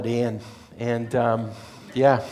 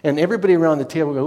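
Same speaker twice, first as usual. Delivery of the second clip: normal talking voice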